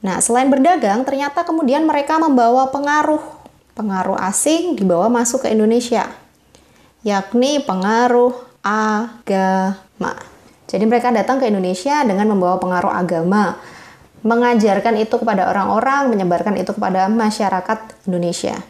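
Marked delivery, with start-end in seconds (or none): none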